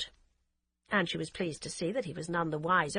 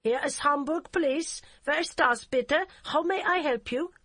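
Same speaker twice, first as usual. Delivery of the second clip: put-on heavy German accent; 8 semitones higher, 5 dB louder than usual. Hamburg policewoman answering the phone in a curt, heavy German accent. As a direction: clip it like a stiff German officer. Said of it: "with an accent so heavy".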